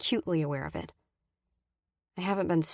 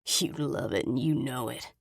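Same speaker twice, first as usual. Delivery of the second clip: lower, slow mocking man; snorting taunt; 5 semitones lower, 3 dB quieter than usual. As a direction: sneer it lazily. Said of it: "he snorts".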